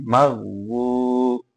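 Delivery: মাগু with a long held final u; slow, intoned মাগু; demonstrated recitation. Shown as a demonstration. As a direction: draw out the last vowel of মাগু on a steady note. Demonstrates the letter with long vowel.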